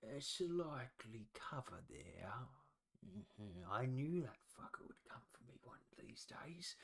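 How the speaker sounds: hushed, bitter threat; an old man's muttered threat; menacing whisper. Acted. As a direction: breathe it out like a grudge finally settled. Old man turns menacing.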